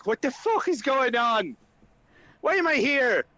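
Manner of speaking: high-pitched incredulous protest; Flynn, exasperated. Acted. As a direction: demand answers in exasperation. Flynn confronts Ismark over the fog.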